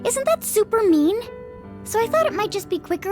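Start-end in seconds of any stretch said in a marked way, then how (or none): 0.0-1.2 pouty complaint, louder; whiny indignation. Acted. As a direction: whine about unfairness. Complaining girlishly.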